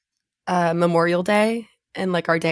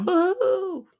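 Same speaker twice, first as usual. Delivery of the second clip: cracking on a high cheer; strained high cheer that breaks; 11 semitones higher than usual. Real, not acted. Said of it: "I can't, like, go high".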